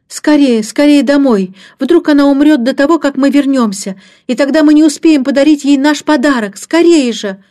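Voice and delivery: put-on high, loud, urgent child's voice; panicked urging. A narrator's reading. Child desperate to reach nanny in time, panicked urging. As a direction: urge them on in alarm.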